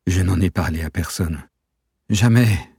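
anguished protest that rises into a cry; moaned denial. A narrator's reading. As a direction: deny it in anguish, bursting out at the end. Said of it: "gémit-il".

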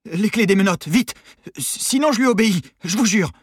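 high, loud panicked threat; trembling voice. A narrator's reading. Nervous prisoner threatens guards with a gun, trembling shout. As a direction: threaten them while losing your nerve. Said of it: "Sa voix trembla".

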